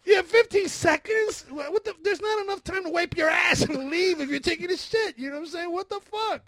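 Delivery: high-pitched, loud comic rant; outraged disbelief. Real, not acted. Man rants about a useless warning app, outraged. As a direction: rail at it in incredulous outrage.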